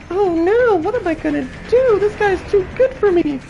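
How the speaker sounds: high, whiny falsetto fake panic; mocking Ada. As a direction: play a helpless survivor in a squeaky falsetto. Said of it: "Ada's like".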